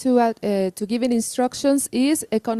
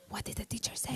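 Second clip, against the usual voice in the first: whispered student question; 18 dB quieter, faster than usual. Retold or imitated. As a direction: whisper it to a classmate. Confused student asking neighbour.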